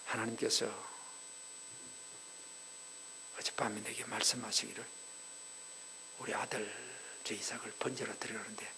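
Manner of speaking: soft, low, near-whispered plea to his wife; husband softly breaking the news to his wife; hushed husband. Retold or imitated. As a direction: lean in and murmur it. Abraham hushed, telling Sara.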